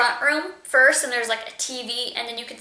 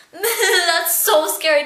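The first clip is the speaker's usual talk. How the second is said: nervous laugh; 6 semitones higher, 4 dB louder, much slower than usual. Real, not acted.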